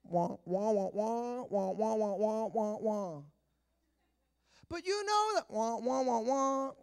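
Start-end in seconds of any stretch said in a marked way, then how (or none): 0.0-3.2 Charlie Brown adult wah wah voice; trombone-like wah wah droning; muffled wah wah talk. Retold or imitated. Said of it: "You ever hear adults talk on Charlie Brown?". 5.5-6.7 lightly voiced Charlie Brown adult; a hint of wah wah droning; muffled wah wah talk. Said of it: "You ever hear adults talk on Charlie Brown?".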